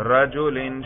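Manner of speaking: "رجلن" ending in -in; "رجلن" with an i before the final n; genitive ending. Shown as a demonstration. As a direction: say "رجلن" as rajulin. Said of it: "جر پہ تلفظ کریں".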